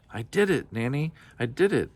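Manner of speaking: high, delighted little-dragon squeal; a read-aloud squeal of joy. A narrator's reading. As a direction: squeal it like a proud child. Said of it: "she squealed with delight".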